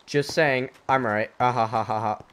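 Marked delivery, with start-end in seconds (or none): 1.4-2.2 cartoon laugh. Gloating after "I'm right.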